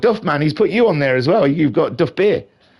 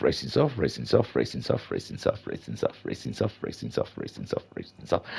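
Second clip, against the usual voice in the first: fading echo; 14 semitones lower, 11 dB quieter than usual. Pretend. Commentator checks everyone's tyres, repeating in a fading mutter. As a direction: sink very low and trail into a whisper. Comic repetition fading to nothing.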